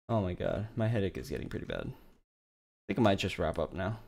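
ordinary talk, nothing marked

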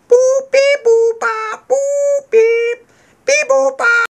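high loud robot beeps; toy robot voice. Pretend. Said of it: "I am a robot".

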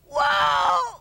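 loud, high shout; ecstatic whoa. Real, not acted. Preacher exclaiming in wonder, loud. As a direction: cry out in wonder.

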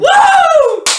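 high loud whoop; excited whoop. Real, not acted. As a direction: whoop with joy.

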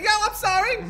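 very high, squeaky mock apology; Chris Tucker. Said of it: "Why does he sound like Chris Tucker?".

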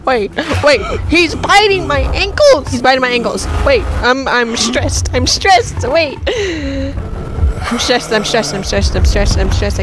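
loud, breathless frantic pleading; panicked. Real, not acted. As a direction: gabble in panic as he bites you. Monster biting, panicking.

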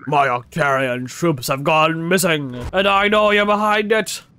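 slow, put-on villain voice; accusing game villain. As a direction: accuse with slow theatrical weight.

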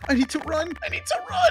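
mock panic. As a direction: squeak it out in rising panic. Fleeing the horde.

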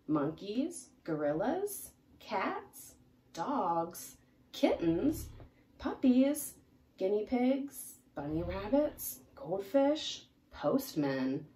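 plain reading voice

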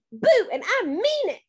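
high, insistent shout. A narrator's reading. Kid clown insisting on being scary, shouting. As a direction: shout it like you mean business. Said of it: "I shouted".

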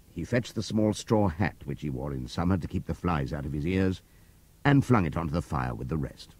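plain reading voice

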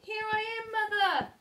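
high, loud call up to the plane. Acted. The baby bird calling to a passing plane, high and loud. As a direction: call up to the sky for mother. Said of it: "he called out".

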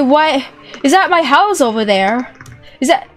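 usual speaking voice

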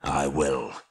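low, grim dwarf voice; grim low resolve. A narrator's reading. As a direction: agree darkly, pitched low. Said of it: "said Thorin grimly".

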